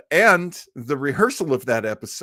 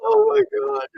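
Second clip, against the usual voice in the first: much higher, amazed disbelief; stunned disbelief; 3 dB louder, faster than usual. Real, not acted. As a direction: let it burst out in disbelief. Host reacting to blooper clip.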